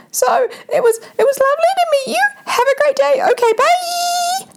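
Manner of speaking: squeaky, very high and fast, slowing on bye; very high-pitched voice. Retold or imitated. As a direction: squeak out a panicked goodbye. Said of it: "my voice went into the highest pitch".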